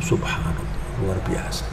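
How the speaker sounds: whispering praise in quiet awe; awed whisper. Shown as a demonstration. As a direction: breathe it out in wonder. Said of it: "Orang yang mikir".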